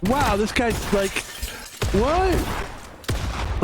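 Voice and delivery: amazed reaction. Retold or imitated. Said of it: "And you're like".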